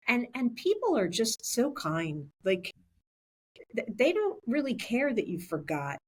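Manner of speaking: normal talking voice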